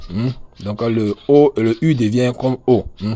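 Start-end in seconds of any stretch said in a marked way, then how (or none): none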